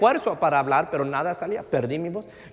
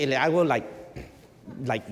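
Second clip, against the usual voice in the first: ordinary delivery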